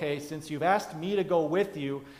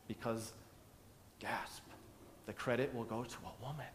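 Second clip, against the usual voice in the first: mock hushed reveal; 4 semitones lower, 14 dB quieter than usual. Dramatic reveal in sermon.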